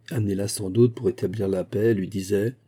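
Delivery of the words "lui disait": ordinary delivery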